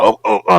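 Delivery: high panicked gasping cries; mock choking oh oh oh; choking gasps. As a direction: gasp like the gas just hit you. Choking on tear gas.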